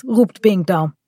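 plain reading voice

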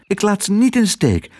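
high, determined protest; protesting cry. A narrator's reading. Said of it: "riep Otje".

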